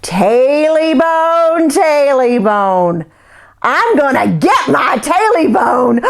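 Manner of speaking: high, very slow ghostly wail; eerie creature voice. Acted. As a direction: stretch each word like a spirit in the dark. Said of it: "right by his ear".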